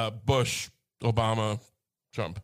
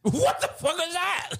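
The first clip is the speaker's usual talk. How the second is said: incredulous; 12 semitones higher, slower than usual. Real, not acted. Outraged disbelief at friend.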